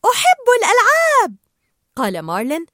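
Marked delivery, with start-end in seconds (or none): 0.1-1.4 high, loud squeal of delight; excited shout. A narrator's reading. Dory hearing there will be a game, shouting gleefully. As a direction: squeal it out like a thrilled child. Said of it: "فصاحت دوري".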